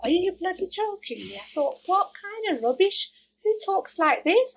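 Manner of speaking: high, outraged, incredulous; who says that, appalled; indignant disbelief. Retold or imitated. Inner reaction to doctor.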